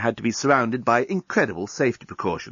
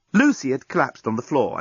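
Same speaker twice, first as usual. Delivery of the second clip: plain reading voice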